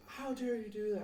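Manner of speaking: high-pitched mock scolding; put-on scandalized voice; outraged bus driver. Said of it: "the bus driver was like".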